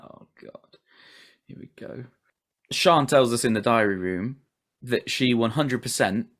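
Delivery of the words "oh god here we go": soft unvoiced groan of boredom; weary mutter. Zoning out at a couple on screen, whispered weary groan. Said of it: "I'm like".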